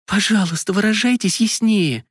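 shaky, frightened plea; terrified pleading hostage. A narrator's reading. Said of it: "дрожащим голосом попросила Марина".

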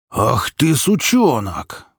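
high, drawn-out angry roar; enraged roar. A narrator's reading. Mikola raging at his son, roaring. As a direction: roar it, stretching the last word. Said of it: "взревел Микола".

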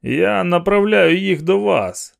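high, drawn-out call; shouting. A narrator's reading. Man telling police he sends women over, shout. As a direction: shout it slowly across the distance. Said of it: "крикнув він".

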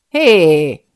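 loud shouted intervention; sharp challenge. A narrator's reading. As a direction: call out hard to stop him. Rescuer calls out to assailant. (hangos rákiáltás)

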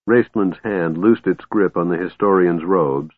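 ordinary delivery

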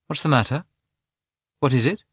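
mildly alarmed wife; concerned questioning. A narrator's reading. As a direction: ask with sudden concern, voice lifted. Wife notices husband's changed expression.

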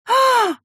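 sharp high startled cry; startled scream. Acted. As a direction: yelp in sudden fright. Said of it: "вскрикнула".

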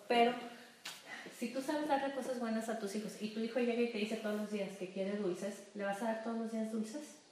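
ordinary talk, nothing marked

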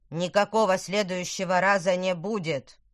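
loud, cold, final verdict; icy tone. Acted. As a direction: cut him off coldly. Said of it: "сказал Тыквер ледяным тоном, как отрезал". (ледяным тоном)

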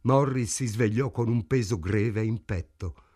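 plain reading voice

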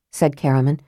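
usual speaking voice

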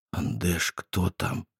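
hushed frightened whisper. A narrator's reading. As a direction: barely breathe the words. Scared whisper in closet.

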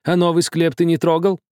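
high-pitched anxious question; eager probing. A narrator's reading. Old man asks about the new crypt, eager.

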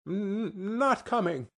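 hesitant mother. A narrator's reading. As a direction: break the news with a halting first sound.

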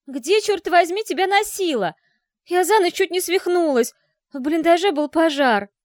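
high furious yell. Acted. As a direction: yell at her sister. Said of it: "заорала".

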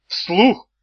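high, loud indignant yelp; indignant outburst. Acted. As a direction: shoot up in outrage. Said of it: "взвился Чемоданов".

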